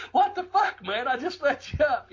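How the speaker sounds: high, laughing mock outrage; a laughing high-pitched what the hell; exasperated retold shout. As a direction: squeak it out in disbelief, half laughing.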